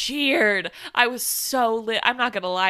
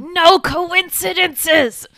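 thrilled quote; 7 semitones higher, 7 dB louder, much slower than usual. Acted. Quoting the movie line excitedly.

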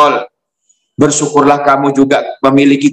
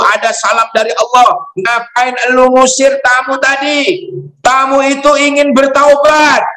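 scolding Jibril. Acted. Angel Jibril rebukes Musa for sending away the sinner, much higher scolding voice. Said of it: "Malaikat Jibril menegur Nabi Musa".

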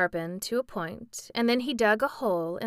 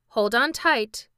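a raised, careful warning; cautioning; 4 semitones higher, 7 dB louder than usual. A narrator's reading. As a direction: warn him to hold tight. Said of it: "he cautioned".

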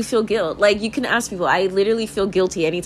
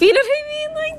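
giggling falsetto, cracking up; high laughing squeal; faster than usual. Real, not acted. Vlogger cracking up at her own tree joke, laughing falsetto.

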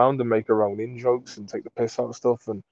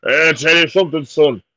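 louder, slow proud dad voice; dad's boast; 4 semitones higher, 6 dB louder, slower than usual. Retold or imitated. Dad boasting to son he downed the beer. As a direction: gloat like a satisfied Irish dad. Dad's gruff retort quoted.

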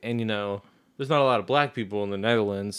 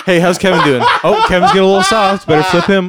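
mock fluffer voice; 7 semitones higher, 8 dB louder than usual. Mocking a fluffer at work.

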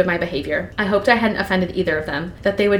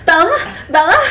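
high, loud hammed-up yelp; mock-alarmed calling out; panicky mother; 10 semitones higher, 6 dB louder than usual. Mother as Bella trips, mock panic. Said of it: "Bella trips at the store".

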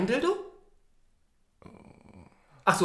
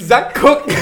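ordinary talk

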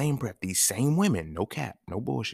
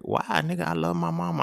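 put-on much higher, indignant kid voice; protesting little boy; 10 semitones higher than usual. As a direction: whine it like a small boy defending his mama.

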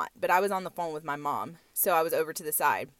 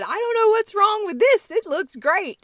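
hammed-up tow truck man, much higher; mock tow truck guy; 12 semitones higher, 6 dB louder than usual. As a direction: play the tipsy tow driver, pitched way up. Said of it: "And he said".

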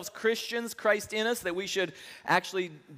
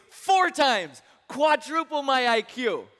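a loud, higher, excited demand, the last part slower; eager shout; 6 semitones higher, 4 dB louder, slower than usual. Acted. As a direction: shout out the greedy wish, slowing on quadruple. Third guy demands four times.

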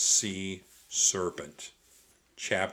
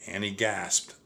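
plain reading voice

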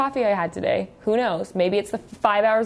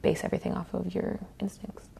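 whispering; 8 dB quieter than usual. Real, not acted. Whispered question.

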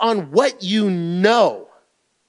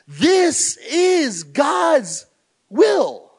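higher, very slow, stretched words; slow proclamation; 6 semitones higher, much slower than usual. Shown as a demonstration. Pastor quoting scripture to his listeners, slow and emphatic. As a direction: lay each word down heavily. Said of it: "it clearly says".

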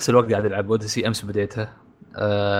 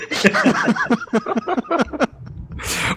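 high, hearty laugh; real amused laugh; burst of laughter; 12 semitones higher than usual. Real, not acted. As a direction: laugh out at the blunder. Host laughs at the mistake.

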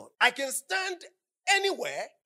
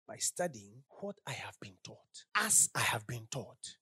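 much softer, lower, near whisper; 11 semitones lower, 9 dB quieter than usual. Real, not acted. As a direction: drop to an intimate whisper.

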